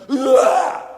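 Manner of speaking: quick full-volume beast bellow; werewolf's short roar. Acted. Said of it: "And the werewolf went".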